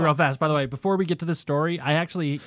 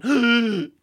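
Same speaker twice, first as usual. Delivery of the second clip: gasp; 7 semitones higher, 4 dB louder than usual. Retold or imitated. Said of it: "waking up going".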